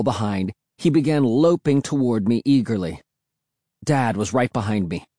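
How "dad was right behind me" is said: ordinary delivery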